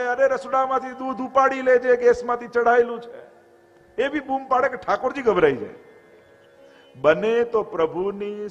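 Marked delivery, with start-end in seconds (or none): 0.0-3.3 calling out toward the kitchen, raised and higher; a retold holler about the milk on the gas; mock household shout. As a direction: imitate someone yelling from the shrine room. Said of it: "એવી બૂમો પાડે".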